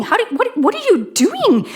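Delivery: a startled mind questioning itself; bewildered inner voice. Retold or imitated. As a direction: inner voice at a loss for what's going on. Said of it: "My head is saying".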